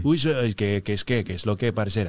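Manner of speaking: low-pitched paisa Colombian caricature. Retold or imitated. Comedian mocking imitations of Colombians, paisa accent. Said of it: "si todos hablaran como [name]".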